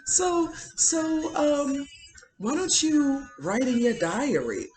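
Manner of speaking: high-pitched, mocking mimicry of a taunter; cynical taunt. Imitating a wife taunting her husband about his diary, cynical. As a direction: taunt him in a high mock voice. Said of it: "she's just cynical".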